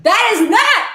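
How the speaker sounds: high, loud burst of disbelief; outraged cry. Retold or imitated. Reacting to a guy baking enchiladas, appalled high cry. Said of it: "And I'm like".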